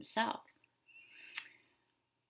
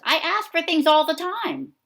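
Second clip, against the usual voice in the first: much higher, loud demanding pigeon; bossy pigeon; 10 semitones higher, 11 dB louder than usual. Acted. Teacher voicing the demanding pigeon, loud and high. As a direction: brag it like a spoiled bird. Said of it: "here, he says".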